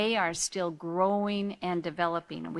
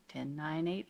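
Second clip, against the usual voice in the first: counting down calmly; 5 semitones lower, 11 dB quieter than usual. Shown as a demonstration. Parent calming down, counting softly backwards. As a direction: count down quietly to calm yourself. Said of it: "then we can say".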